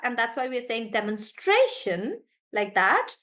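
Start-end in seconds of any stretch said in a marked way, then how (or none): 0.9-2.2 demonstration with STRA stressed; stress before "shun". Shown as a demonstration. Teacher explaining the shun rule, demonstration stressed on stra. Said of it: "we stress on the one before".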